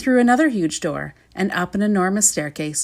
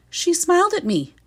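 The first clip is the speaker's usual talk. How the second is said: outraged report; 10 semitones higher than usual. Acted. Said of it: "repeated the soldier".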